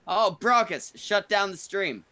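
loud, high-pitched command; calling out an order. Real, not acted. Host orders Brockus to end the stream, calling out loudly. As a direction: call it out sharply across the stream.

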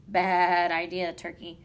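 loud, slow, drawn-out bird call; rooster's scolding. A narrator's reading. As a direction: stretch it out like a rooster. Rooster scolds Turkey in story.

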